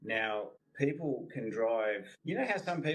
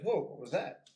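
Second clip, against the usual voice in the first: higher, a lightly voiced startled horse; 7 semitones higher than usual. Trainer voicing a horse spooked by the flag, higher startled. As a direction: play the horse jumping at the flag.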